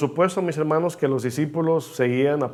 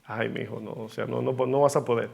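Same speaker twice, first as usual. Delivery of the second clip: much softer and gentle, a loving letdown; tender; 3 semitones lower, 3 dB quieter than usual. Retold or imitated. The Lord answers his overconfident child, soft and tender. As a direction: let him down softly with tenderness. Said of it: "nos mira así con ternura y dice".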